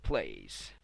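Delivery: usual speaking voice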